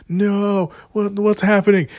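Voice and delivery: imitating the stunned audience, voice jumping up; mock disbelief, as if the hero just vanished; a hammed-up shocked moviegoer; retold shocked gasp. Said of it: "this audible gasp in the movie theater".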